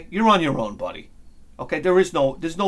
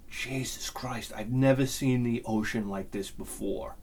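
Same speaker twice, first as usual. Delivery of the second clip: soft, low, slow awe; hushed disbelief; 5 semitones lower, 10 dB quieter than usual. Retold or imitated. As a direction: breathe it out in stunned disbelief. Awed reaction to huge waves.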